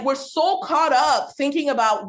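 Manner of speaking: normal talking voice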